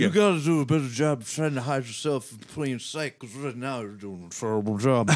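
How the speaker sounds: slurred, garbled caricature; mush-mouthed mock ramble; drunken marble-mouth mumble. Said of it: "You sound like my friend Taylor when he's drunk".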